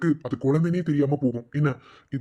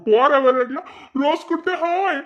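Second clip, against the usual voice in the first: high, loud mocking voice; mocking tease; 11 semitones higher, 6 dB louder, slower than usual. Friend ribs friend about his crush, loud high mockery. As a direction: tease in a raised, exaggerated pitch.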